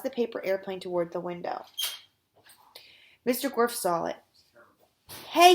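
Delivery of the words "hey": short, loud "hey" shout. A narrator's reading. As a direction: call out sharply to stop someone. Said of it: "he shouted".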